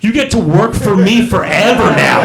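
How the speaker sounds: lightly acted domineering boss announcement; screaming chef. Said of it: "he screams at you".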